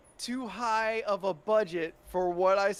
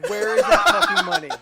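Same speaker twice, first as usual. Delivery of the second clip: loud, high-pitched incredulous outburst; outraged demand; 13 semitones higher, 12 dB louder than usual. Real, not acted. Co-host challenging a samurai film's budget, loud outrage. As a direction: demand it at the top of your voice.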